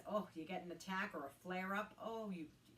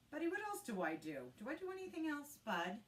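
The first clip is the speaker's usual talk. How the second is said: higher, doting questions to the pet; talking to the dog; 8 semitones higher than usual. Real, not acted. Asking Buddy the dog in playful pet talk. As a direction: ask the dog like he might answer.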